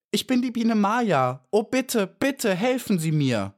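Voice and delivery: high-pitched, begging bee voice; pleading. A narrator's reading. Trapped bee begs the beetle to help her, pleading. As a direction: beg for help, voice held high.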